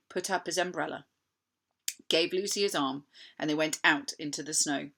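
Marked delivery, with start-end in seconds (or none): none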